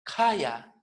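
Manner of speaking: "kaya" with a puff of air after K; aspirated K. Shown as a demonstration. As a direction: breathe through the K of "kaya". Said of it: "Es kaya".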